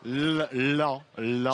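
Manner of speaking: broken first syllable, lan- lan-; sounding out words. Shown as a demonstration. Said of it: "Sors ta langue".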